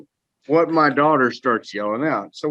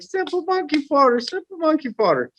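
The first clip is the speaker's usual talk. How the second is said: put-on much higher, squeaky toddler parroting; imitating a toddler. Dad mimics his clapping toddler, high and gleeful. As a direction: chirp it like a delighted little kid. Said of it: "She's like".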